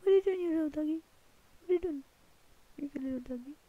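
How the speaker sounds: high, sing-song pet voice, ending in a whisper. Real, not acted. Gamer cooing at a dog in the game, high baby talk. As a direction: coo at the little dog.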